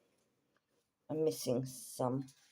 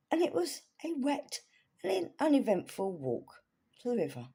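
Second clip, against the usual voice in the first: reading the diary in a posh lady's voice, higher; 6 semitones higher than usual. Shown as a demonstration. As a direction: play the refined English lady author, pitched up. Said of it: "Had to do the posh voice".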